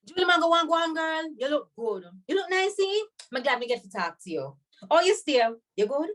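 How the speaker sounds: high, lively patois "You look nice, eh?"; friendly Jamaican patois hello; Jamaican patois greeting. Shown as a demonstration. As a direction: greet her warmly in patois. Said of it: "How do I greet you?".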